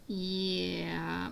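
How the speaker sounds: ordinary talk, nothing marked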